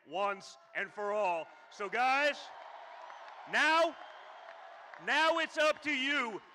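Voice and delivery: building to a shouted rally cry; rally speech. Acted. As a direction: fire the crowd up to the finish.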